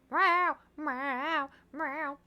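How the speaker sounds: high drawn-out cat meows; cat meowing. Retold or imitated. Showing how her cat loves his own voice, high meows. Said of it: "He just".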